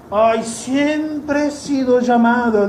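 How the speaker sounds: high, slow whiny woman's voice; mimicking a sister from church. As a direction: play the sister, pleading and high. Mimics woman met in Mexico.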